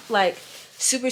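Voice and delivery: ordinary talk, nothing marked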